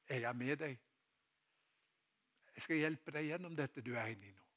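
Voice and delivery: soft, comforting voice after the call; gentle reassurance. Acted. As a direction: quietly promise help to someone afraid. Jesus reassuring the frightened listener. (mildt og trøstende)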